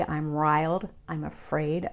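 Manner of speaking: normal talking voice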